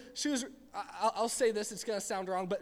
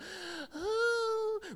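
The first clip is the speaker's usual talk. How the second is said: gasp; 12 semitones higher than usual. Acted. Reacting to the parental nudge.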